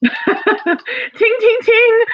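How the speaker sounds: high, ringing "ting" sounds; mock drum roll. Host improvising a drum roll before the announcement, playful high tings. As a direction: sing out a makeshift drum roll flourish. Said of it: "need a drum roll, please".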